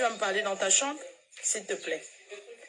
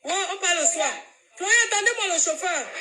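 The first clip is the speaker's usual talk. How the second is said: high-pitched call to a driver; 9 semitones higher than usual. Real, not acted. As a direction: call out urgently to make the driver wait. Calling to the driver to wait.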